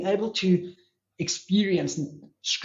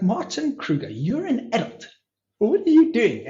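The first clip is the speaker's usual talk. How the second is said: mock self-scolding; 7 semitones higher than usual. Meditator telling himself off for nearly crying, mock scolding. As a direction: lightly scold yourself like a child.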